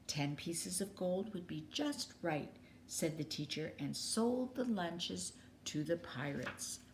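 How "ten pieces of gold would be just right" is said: hushed whisper. Acted. Teacher quietly accepting the offer.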